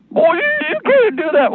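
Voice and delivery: high whiny mock protest; mock objector. Imitating a scandalised mask supporter, high and whiny. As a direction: squeak it like a scandalised busybody.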